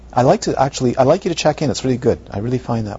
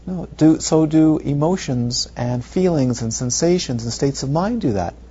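usual speaking voice

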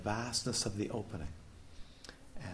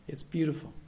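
usual speaking voice

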